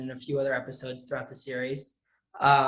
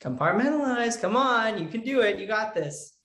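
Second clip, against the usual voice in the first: higher-pitched imitation of an encouraging mom; mock motherly urging, pitched up; mom pep talk; 6 semitones higher than usual. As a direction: egg the kid on like a coaching mom. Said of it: "my mom will be like".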